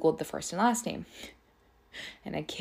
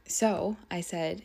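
normal talking voice

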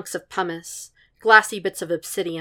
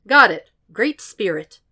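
trying not to condescend; 3 semitones higher, 8 dB louder than usual. A narrator's reading. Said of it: "without condescension".

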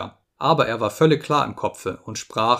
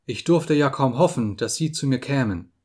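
usual speaking voice

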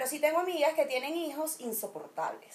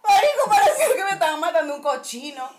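laughing; 5 semitones higher, 11 dB louder than usual. Real, not acted. Laughing at own punishment story.

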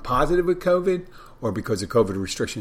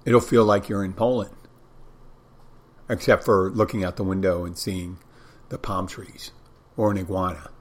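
ordinary delivery

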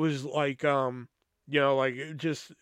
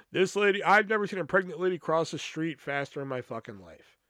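normal talking voice

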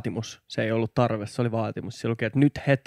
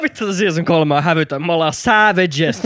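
loud, high excited boast; hyped bragging; 5 semitones higher, 6 dB louder than usual. Real, not acted. Boasting season three is shameless, hyped loud shout. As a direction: crow it at full energy, drawing out the end.

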